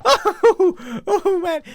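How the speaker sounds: high, laughing through the words. Real, not acted. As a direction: still laughing from the shock. Laughing after being startled.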